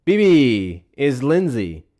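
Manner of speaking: loud, slow, mock-gushing phone greeting; mocking sugary greeting. Host mocking a senator's call to Netanyahu, loud and fawning. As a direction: ham up the fawning hello to B B. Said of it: "opening up this phone call with".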